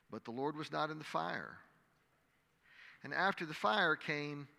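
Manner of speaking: measured, dramatic Bible reading to a congregation; scripture reading. Acted. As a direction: read the passage aloud like a preacher. Reads the scripture passage.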